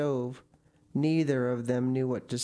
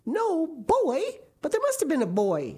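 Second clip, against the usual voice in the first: firm, higher, louder protest; insistent Marilla; 5 dB louder than usual. A narrator's reading. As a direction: insist there must be a boy. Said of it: "insisted Marilla".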